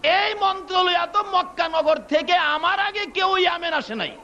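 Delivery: high, ringing cry of amazement; astonished father. Acted. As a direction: exclaim it high in wonder. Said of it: "Habib বিন মালিক কয়". (বিস্ময়ে চড়া গলায়)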